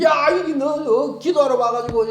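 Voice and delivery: the priest Eli yells at a woman; scolding priest. Retold or imitated. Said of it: "엘리가 얘기했죠". (호통치는 제사장)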